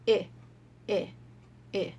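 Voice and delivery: short "Eh" sounds, three beats; letter sound i. Shown as a demonstration. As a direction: clip each "Eh" short and even.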